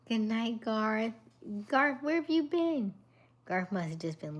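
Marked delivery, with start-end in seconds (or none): 0.1-2.9 high, loud delighted welcome; excited greeting. Real, not acted. Host greeting returning viewer Garth, thrilled.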